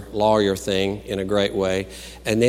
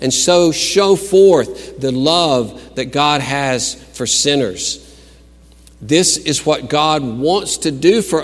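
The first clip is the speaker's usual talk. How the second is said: emphatic, raised sermon delivery; preach; 5 semitones higher, 5 dB louder than usual. Acted. Preacher telling the congregation what God wants, emphatic preaching. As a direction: press each point home like a preacher.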